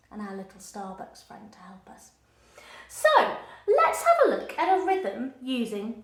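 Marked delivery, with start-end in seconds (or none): 0.1-2.1 soft whispered aside. Real, not acted. Teacher adds a playful whispered aside to children. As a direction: breathe it out as a secret.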